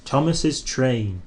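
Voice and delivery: usual speaking voice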